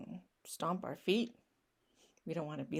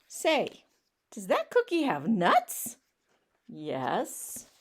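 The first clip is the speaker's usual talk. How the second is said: incredulous pigeon; 5 semitones higher, 5 dB louder, slower than usual. Acted. Pigeon questions duckling, incredulous.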